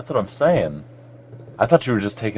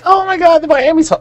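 much higher, louder mock squeal of excitement; mock gushing fan; 8 dB louder than usual. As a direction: gush like a starstruck fan. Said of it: "People are like".

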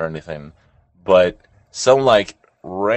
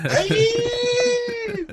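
high cheering hey; excited shout. Real, not acted. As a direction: yell it out in celebration. Celebratory shout.

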